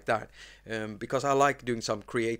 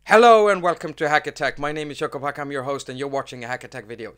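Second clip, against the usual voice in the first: hack face, no smile; 3 semitones higher, 10 dB louder, faster than usual. Shown as a demonstration. Host tests his intro without smiling, loud and grim. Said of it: "let's try to do the hack face and say this".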